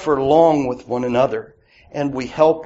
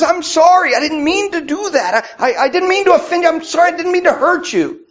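high, louder, fast pleading apology; pleading brother; 4 dB louder, faster than usual. Retold or imitated. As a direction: beg forgiveness a second time, faster. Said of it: "But he says".

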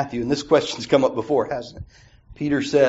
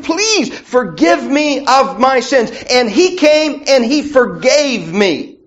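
preaching at full force; 10 semitones higher, 5 dB louder than usual. Real, not acted. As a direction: drive the point home to the congregation.

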